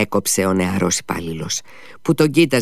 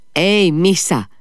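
louder call down the hall; 10 semitones higher, 5 dB louder, slower than usual. A narrator's reading. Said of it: "φώναξε στο χολ". (φωνάζει στον Μίσα στο χολ)

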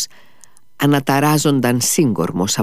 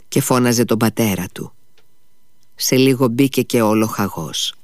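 plain reading voice

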